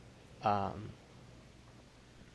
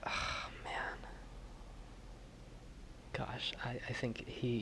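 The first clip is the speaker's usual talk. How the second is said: breathless, whispered awe; real hushed whisper, moved by the scene; choked whisper; 10 dB quieter than usual. Real, not acted. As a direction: barely get the words out. Player overcome at sad scene.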